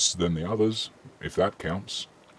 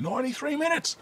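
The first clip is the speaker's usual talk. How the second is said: much higher, startled exclamation; real surprise at how long it's run; shocked; 4 dB quieter than usual. Real, not acted. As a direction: blurt it out in disbelief. Shocked at stream length.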